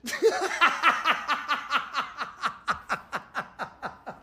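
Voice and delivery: long rattling cackle, trailing off; mocking laugh. Real, not acted. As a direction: cackle helplessly at the absurdity. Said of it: "get through this post without laughing".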